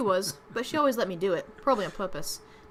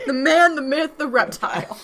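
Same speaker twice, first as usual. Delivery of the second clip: loud, higher hammed-up announcer build; mock grand introduction; 5 semitones higher, 8 dB louder, slower than usual. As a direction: present it like a hyped legend.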